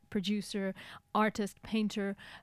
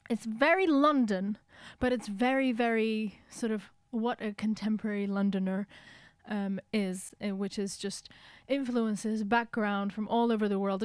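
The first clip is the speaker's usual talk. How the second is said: ordinary delivery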